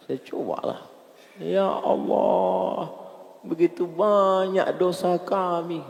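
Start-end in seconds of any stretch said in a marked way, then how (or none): 1.4-5.8 high, slow, pleading lament; muhasabah prayer leader. Retold or imitated. Ustadz acting his attempt to make worshippers cry, pleading lament. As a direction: draw out each phrase, trying to make them weep. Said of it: "Ustadz buat orang ini menangis".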